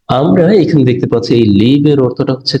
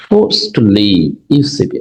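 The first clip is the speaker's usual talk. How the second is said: slow reading; much slower than usual. Shown as a demonstration. Teacher reads example sentence.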